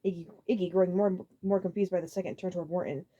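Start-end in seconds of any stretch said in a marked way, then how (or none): none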